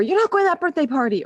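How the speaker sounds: fast, high, loud hammed-up scolding; mock angry parent. Parent banning a child from a party in a hammed-up shout. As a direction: snap it out like an overdone strict parent.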